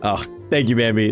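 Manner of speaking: usual speaking voice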